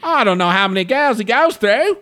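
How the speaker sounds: loud, high-pitched mock Aussie-Cockney; Australian-Cockney accent. Co-host as the flower guy, mock Australian-Cockney accent. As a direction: shout it as a hammed-up Australian Cockney. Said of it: "my Australian slash English Cockney accent".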